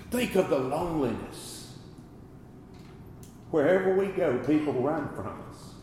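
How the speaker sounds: ordinary delivery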